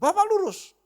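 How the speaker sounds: very high, loud mock order; exaggerated phone-direction shout; insistent command. Husband telling the driver to go straight, loud and insistent.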